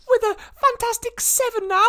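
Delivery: very high, loud squeaky pipe-up; Chuck. Acted. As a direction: butt in with a shrill little cartoon voice. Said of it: "you hear Chuck pipe up".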